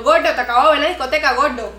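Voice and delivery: loud mock callout to the guy; calling him out. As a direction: call him out on the lie. Retorts to the man from the club.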